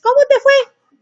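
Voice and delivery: hammed-up nosy mom asking her son about his day; prying parent. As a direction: pester the teen with a bright mock question. Pushy parent questioning teen.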